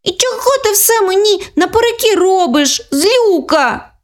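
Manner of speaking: put-on high fed-up child voice; she's had enough of her sister; exasperated little girl. Younger sister losing patience, high exasperated voice. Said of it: "не стерпіла Світланка".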